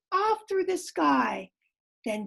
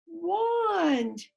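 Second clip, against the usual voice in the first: plain reading voice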